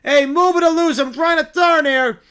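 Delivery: loud, high impatient holler; yelling taxi driver. Acted. Taxi driver yelling at children in the road, impatient. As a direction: shout it out of the cab window. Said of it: "a taxi driver leans out his window".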